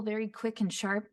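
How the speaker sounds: ordinary talk, nothing marked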